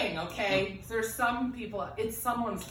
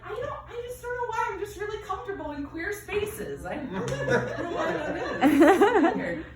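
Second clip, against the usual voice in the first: clueless past self; 12 semitones higher, 5 dB louder than usual. Retold or imitated. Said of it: "I used to say things like".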